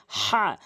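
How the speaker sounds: হা forced out from mid-throat; pressed big ha. Shown as a demonstration. Teacher showing the correct letter, pressed throaty হা. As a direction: press a strong rasping h in হা from the throat. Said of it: "চাপ দিয়ে বলতে হবে".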